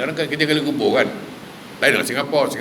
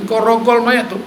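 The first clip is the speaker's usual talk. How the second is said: high, louder appalled question; retold angry disbelief; 8 semitones higher, 5 dB louder than usual. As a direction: imitate an indignant demand for confirmation. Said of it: "Nabi marah pun". (pertanyaan marah yang ditirukan)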